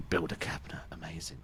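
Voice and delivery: unvoiced whisper of the catchphrase; whispered callback. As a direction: breathe it as a secret.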